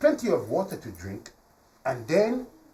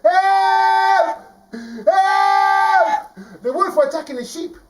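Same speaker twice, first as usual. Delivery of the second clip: high, loud drawn-out cries of alarm; acted boy's urgent holler; yelling for help; 10 dB louder, much slower than usual. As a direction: scream it down to the whole village. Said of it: "and yells down".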